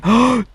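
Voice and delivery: high surprised intake of breath; sharp gasp. Real, not acted. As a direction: gasp of amazement. Truck pulls free.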